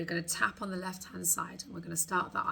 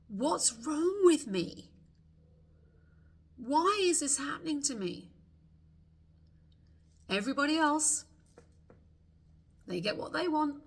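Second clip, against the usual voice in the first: higher and louder, exasperated cry; frustrated outburst; 7 semitones higher, 4 dB louder than usual. Acted. Coach voicing the listener's anger, exasperated higher outburst. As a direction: let the frustration burst out, pleading. Venting frustration in tapping script.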